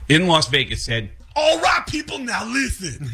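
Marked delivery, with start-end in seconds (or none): 1.4-3.1 much higher, rock-star stage call. Retold or imitated. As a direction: boom it like a frontman. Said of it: "I'm doing that voice".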